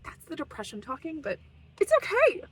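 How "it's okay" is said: put-on voice. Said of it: "I don't talk like that".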